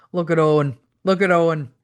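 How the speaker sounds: put-on English accent, hooted with glee; hooting English accent. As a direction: hoot it out like a British wrestler in thick English. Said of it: "Bulldog hooted in his thick English accent".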